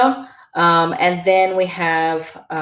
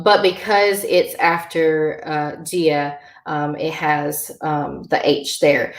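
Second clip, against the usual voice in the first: usual speaking voice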